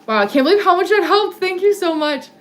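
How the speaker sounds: high, amazed burst of thanks; excited gratitude. Real, not acted. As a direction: gush thanks, pitched well up. Thanking viewer for a helpful tip.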